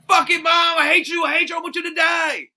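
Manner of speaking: high, loud bratty yell at dad; bratty kid yelling. Retold or imitated. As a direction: scream it like a spoiled teenager.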